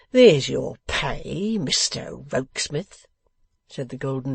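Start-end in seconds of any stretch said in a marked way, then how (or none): 0.1-3.0 slow, loud opening, scornful; scornful dismissal. Acted. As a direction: pay him off with disdain. Boffin scornfully pays off secretary.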